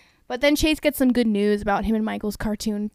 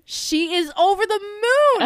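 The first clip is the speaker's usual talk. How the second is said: gushing delight; 9 semitones higher, 4 dB louder than usual. Real, not acted. Gushing about the reunion.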